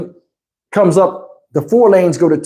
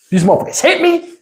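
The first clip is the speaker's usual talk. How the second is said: 1 outraged report; 8 semitones higher, faster than usual. Retold or imitated. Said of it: "I'm like".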